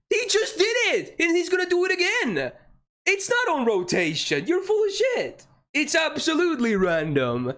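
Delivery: high, indignant outburst; real angry protest. Real, not acted. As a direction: protest furiously in a high pitch. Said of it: "I'm mad".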